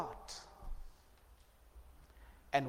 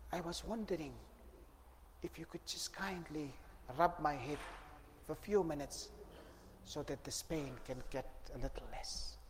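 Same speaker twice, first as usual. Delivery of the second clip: pained murmur; 10 dB quieter than usual. Acted. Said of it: "her voice is reflecting pain".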